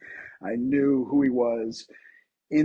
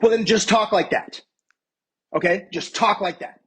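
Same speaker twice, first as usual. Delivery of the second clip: loud, high imitation of an insistent boss; retold blunt correction; 8 semitones higher, 6 dB louder than usual. Show creator correcting actor's accent in front of everybody, insistently.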